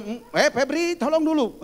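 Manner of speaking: high, loud call for help; urgent mock call to a helper. Assistant calls helper about smelly child, calling out.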